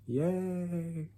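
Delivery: high, soft, deadpan fake cheer; sarcastic yay. Real, not acted. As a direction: cheer without meaning it at all. Sarcastic reaction to lifelong medicine.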